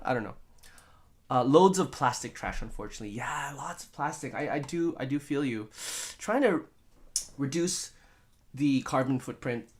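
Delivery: usual speaking voice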